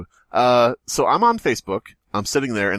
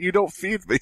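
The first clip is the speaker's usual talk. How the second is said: higher, thin mock whine; mock captive complaint; 8 semitones higher than usual. Host voicing the ex as a starved captive, mock whine. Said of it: "It's like".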